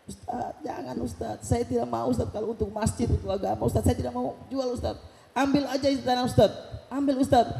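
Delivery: suara Pak Haji yang tinggi dan lirih; menirukan Pak Haji. Retold or imitated. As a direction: tirukan bapak tua yang menolak dengan tulus. (Pak Haji menolak menjual tanah untuk masjid, suara tinggi lirih)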